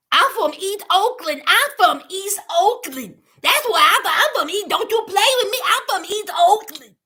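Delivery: lightly voiced tough act; mocking someone who acts hard, a hint of put-on swagger; mocking Mandy's tough talk. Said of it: "'cause she's tough. She's from East Oakland".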